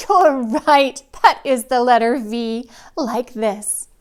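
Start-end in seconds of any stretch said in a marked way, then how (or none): none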